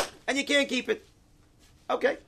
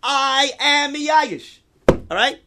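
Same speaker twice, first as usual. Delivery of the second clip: slow top-of-the-lungs yell, much louder; 9 semitones higher, 10 dB louder, much slower than usual. Retold or imitated. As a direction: bellow it out, drawn out. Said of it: "He yells out on top of his lungs".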